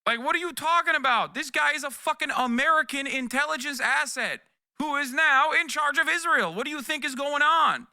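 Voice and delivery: shouting at chat, can't believe the question; incredulous yelling. Real, not acted. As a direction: yell it in outraged disbelief.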